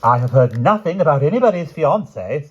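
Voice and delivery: loud, high defiant reply; queen defiantly snubbing the princess. Acted. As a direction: throw it back at her. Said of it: "said the Queen defiantly".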